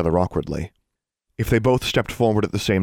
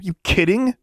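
blurted out; 13 semitones higher than usual. A narrator's reading. Girl challenging what she just heard, blurted out. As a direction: blurt the question out high. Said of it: "she blurted out".

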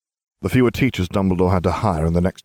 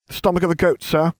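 a higher, smug schoolboy answer; 10 semitones higher, faster than usual. A narrator's reading. Harry showing up his teacher, smugly. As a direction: answer sweetly, knowing you're right. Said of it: "I told him smugly".

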